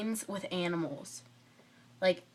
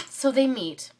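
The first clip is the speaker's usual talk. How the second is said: ordinary talk, nothing marked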